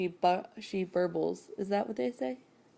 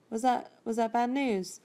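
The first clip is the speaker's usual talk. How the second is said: real casual; 5 semitones higher than usual. Retold or imitated. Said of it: "like, real casual".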